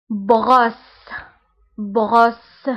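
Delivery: بغوس drawn out very slowly; slow model word. Shown as a demonstration. Teacher modelling a French word for learners, slow. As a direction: stretch بغوس out so the او is heard. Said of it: "لي فوايل اللي عندي هما او".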